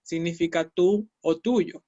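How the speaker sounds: normal talking voice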